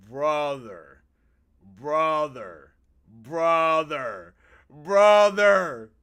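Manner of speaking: drawn-out shouts, climbing in force; appalled exclamation. Real, not acted. Appalled at the husband's line.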